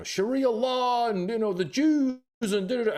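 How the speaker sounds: high-pitched caricature of ranting bigots; mock zealot rant. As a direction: whine it high, mimicking panicky zealots. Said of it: "these Christians who are like".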